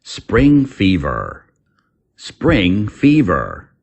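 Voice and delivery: slow, careful teacher's model of the phrase; slow clear model. Shown as a demonstration. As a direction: say it slowly for learners to repeat. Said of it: "Common English expression".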